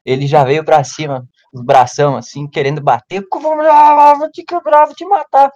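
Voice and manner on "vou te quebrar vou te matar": mock brute threat. Voicing the attacker's threat.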